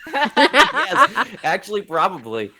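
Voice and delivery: high, loud laughing reply. Real, not acted. Laughing at his own delight.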